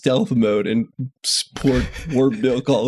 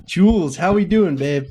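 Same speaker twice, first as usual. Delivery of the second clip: upbeat greeting; 5 semitones higher than usual. Real, not acted. Greeting friend on a call.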